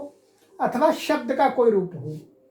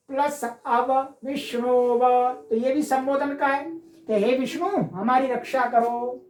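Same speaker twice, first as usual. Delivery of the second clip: usual speaking voice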